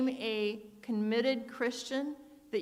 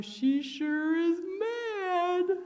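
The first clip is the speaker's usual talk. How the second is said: much higher, slow teasing father voice; amused father; 8 semitones higher, much slower than usual. Retold or imitated. Dad teasing to his daughter in the car, high slow amused voice. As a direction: drawl it out like a dad enjoying the joke.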